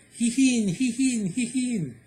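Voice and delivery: high, loud neighing; whinny three times like a horse. Acted. Horse neighs after speech.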